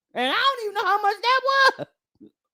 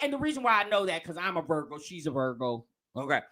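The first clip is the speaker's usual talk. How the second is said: quiet aside; 9 dB quieter, faster than usual. Real, not acted. Aside said far lower, softer.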